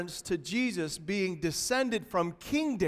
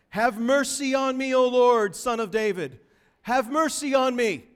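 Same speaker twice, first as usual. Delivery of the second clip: raised-voice plea; 5 semitones higher, 6 dB louder than usual. Retold or imitated. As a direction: cry out for mercy, higher and louder. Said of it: "she's raising her voice".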